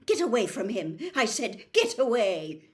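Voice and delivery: loud barked order; sudden shout. A narrator's reading. Riddle shouts at the phoenix to get away. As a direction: shout the bird off.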